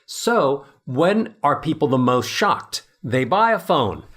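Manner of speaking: ordinary talk, nothing marked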